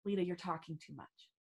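quiet, low-key inner voice; a soft little nudge from within; inner critic. Acted. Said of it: "that little humility check is saying".

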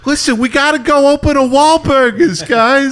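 very high, pleading actor impression; hammed-up urgent appeal to the guys; Mark Wahlberg. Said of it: "Do you think Mark Wahlberg's".

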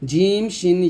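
ordinary talk, nothing marked

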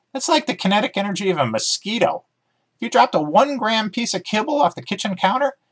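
chirpy dog character, keen to explain; eager talking dog. Acted. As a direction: bounce through it like an excited dog. Dog Emmy voiced in story.

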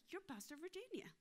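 high, soft voice of an admirer; delighted recognition. Acted. Lady recognises the pastor, high sweet recognition. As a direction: lift it high and soft as you recognise someone.

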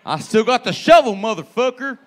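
loud mock threat as the grim father; menacing dad. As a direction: voice the scary dad with a shovel. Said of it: "your dad looks like he's buried a body".

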